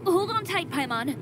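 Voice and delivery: urgent call. Acted. Bracing as the lift drops.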